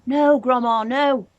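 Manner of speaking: George calling back. A narrator's reading. George begs Grandma to stop, loud and alarmed. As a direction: shout up in alarm. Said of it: "George called back".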